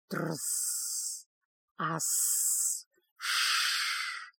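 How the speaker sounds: long voiceless serpent hiss; creature hissing. A narrator's reading. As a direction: hiss softly, stretching each sibilant. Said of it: "шипело неизвестное существо".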